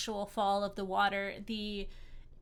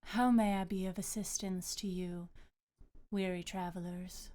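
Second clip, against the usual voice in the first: gracious goddess. Acted. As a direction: speak as a serene water goddess. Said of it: "and she says".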